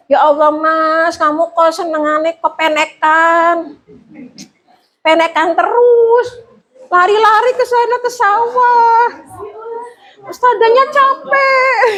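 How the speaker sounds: rising into a high, laughing whine, slowing down; whining lament. Shown as a demonstration. Teacher wailing at a naughty pupil, climbing into a high laughing whine. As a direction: pile on the self-pity until the voice cracks high.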